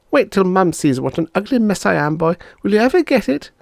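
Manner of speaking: put-on high, rising threatening girl; a little girl threatening to tell; chicken girl. Bonnie threatening Bob, high voice.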